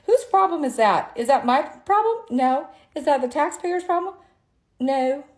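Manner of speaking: fed-up attorney ranting at the camera, rising heat; heated rhetorical rant. Real, not acted. Ranting rhetorical questions at viewers.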